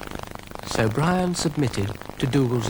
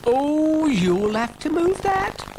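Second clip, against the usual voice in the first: high, slow, officious voice; officious little snail; 3 dB louder, slower than usual. A narrator's reading. Brian the snail orders Dougal to move the caravan, high fussy voice. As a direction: pitch it right up, drawn out and fussy. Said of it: "said Brian".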